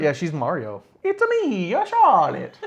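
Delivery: put-on high, loud Mario-style Italian accent; hammed-up Italian accent as Mario; joking she's Mario, in a cartoon Italian accent; mock Mario voice. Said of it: "and then I thought".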